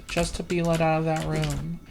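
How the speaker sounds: ordinary talk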